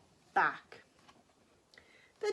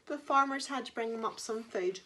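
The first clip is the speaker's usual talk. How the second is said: usual speaking voice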